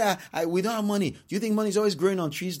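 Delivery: high, rapid-fire mock protest; exasperated retort. Spouse protesting the cost of a request, exasperated. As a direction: stammer in, then rush it out high.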